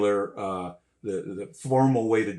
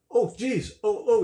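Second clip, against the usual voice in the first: higher, mock-fretful oh geez; panicky drummer; 10 semitones higher, 3 dB louder than usual. The drummer looks around not starting to play, mock panic. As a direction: play the lost, flustered drummer. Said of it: "the drummer was going like".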